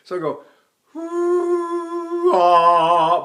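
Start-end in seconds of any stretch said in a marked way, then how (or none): 1.0-2.3 high ooh on leaking breath; leaky falsetto ooh. Shown as a demonstration. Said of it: "a real true falsetto requires leaky breath". 2.4-3.2 louder ah with the breath attached; attached chest ah. Shown as a demonstration. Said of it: "attach my breath to my diaphragm".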